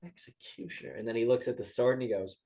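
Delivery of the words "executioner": Executioner. dropped to a much softer falling mutter; dawning realisation. Retold or imitated. Gang boss realising who he faces, soft and falling. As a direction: let the penny drop, quietly. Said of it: "it cuts to the boss and he goes".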